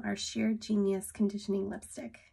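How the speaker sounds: ordinary talk, nothing marked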